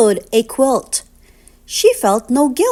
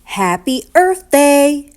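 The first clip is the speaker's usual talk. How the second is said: louder, slower happy shout; cheerful greeting; 3 semitones higher, 5 dB louder, slower than usual. Real, not acted. Reader cheers the book's closing wish, excited. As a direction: celebrate it out loud.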